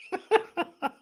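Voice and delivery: high, real burst of laughter; incredulous laugh. Real, not acted. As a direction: laugh in high-pitched disbelief at the decision. Laughs at the referee's decision.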